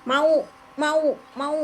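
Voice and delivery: ulang "mau" pelan dan lambat, nada agak tinggi; menirukan anak. Retold or imitated. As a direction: tiru anak kecil yang cuma bisa bilang mau. Anak hanya bilang mau. (menirukan anak berkata mau)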